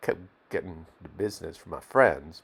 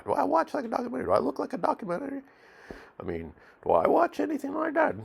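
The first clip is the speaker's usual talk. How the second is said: much higher, fast disbelieving questions; incredulous rant; faster than usual. Retold or imitated. Quotes own incredulous rant.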